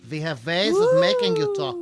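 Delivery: high, loud sinister interrogator voice; mock villain. Host jokes to co-host before the gift unveiling, hammed-up villain voice. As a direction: ham up a movie villain threatening a captive.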